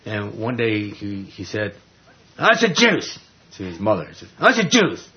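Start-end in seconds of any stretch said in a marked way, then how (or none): 2.4-3.2 high, punchy imitation of a small child's demand; demanding little kid. Small son demanding juice from his mother, imitated high and insistent. 4.4-5.1 high, punchy imitation of a small child's demand; demanding little kid. Small son demanding juice from his mother, imitated high and insistent.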